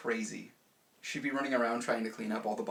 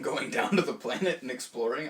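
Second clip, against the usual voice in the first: normal talking voice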